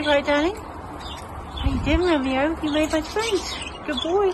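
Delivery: high, cooing pet voice. Real, not acted. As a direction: coo up high at the little bird. Cooing to her pet bird.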